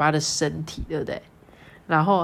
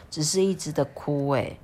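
ordinary delivery, nothing marked

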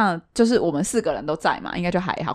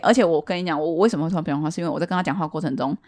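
ordinary talk, nothing marked

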